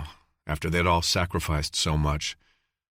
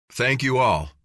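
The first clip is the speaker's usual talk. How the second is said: higher, louder call to the crowd; shouted; 6 semitones higher, 4 dB louder than usual. A narrator's reading. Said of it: "Jensen shouted".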